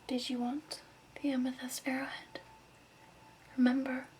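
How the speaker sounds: ordinary delivery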